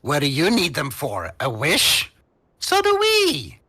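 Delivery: high, excitable sales pitch; cajoling gnome. Acted. Gnome explains to the party why they want creatures, excitable. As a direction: argue back eagerly, jumping high in pitch.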